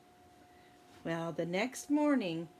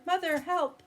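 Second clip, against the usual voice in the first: calling out; 9 semitones higher, 5 dB louder than usual. Acted. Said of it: "Jack called to his mother".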